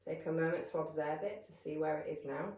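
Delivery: calm, measured meditation voice with long pauses; guided meditation. Real, not acted. Yoga teacher settling listeners into breath awareness, calm guided meditation. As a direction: guide them gently into stillness, leaving space between phrases.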